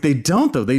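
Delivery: ordinary delivery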